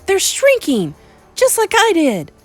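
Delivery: put-on excited boy. Boy watching witches turn to mice, excited. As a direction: cry it out in boyish excitement.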